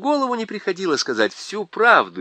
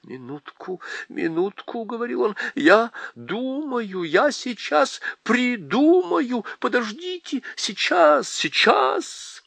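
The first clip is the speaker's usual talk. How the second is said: faun pressing his head, pitch climbing higher; anxious faun; 11 semitones higher than usual. A narrator's reading. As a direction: faun pleading for a moment to think, voice rising. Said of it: "раскачивается, как от боли".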